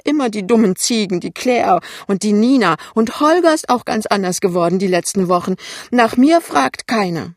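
high, loud wailing tirade; aggrieved mother, near tears and high-pitched; indignant, tearful. A narrator's reading. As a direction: complain whiningly, full of self-pity. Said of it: "entrüstet, aber auch weinerlich".

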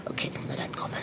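soft resigned whisper. Acted. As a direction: give in, barely voiced.

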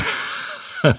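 short forced laugh under the breath; carpenter's uneasy little laugh; nervous breathy chuckle. Acted. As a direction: laugh to hide the fear. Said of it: "Das ist doch zum Lachen".

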